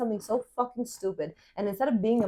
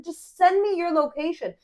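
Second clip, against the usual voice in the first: exasperated demand; 7 semitones higher than usual. Retold or imitated. Acted phone quarrel, repeated demand.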